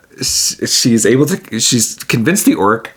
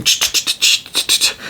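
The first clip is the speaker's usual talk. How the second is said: shaker noise. Retold or imitated. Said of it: "some shakers".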